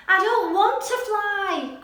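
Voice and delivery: high, slow, tearful-sounding protest; a scared little owl refusing; upset cry. Acted. Little Owl pleading with the fawn, slow upset cry. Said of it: "cried Little Owl".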